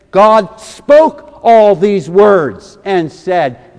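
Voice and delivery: every word of "God spoke all these words and said" slow, loud and weighted; slow emphatic reading. Real, not acted. Preacher reading Exodus twenty to the church, slow and loud. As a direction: lean hard on each word, drawn out.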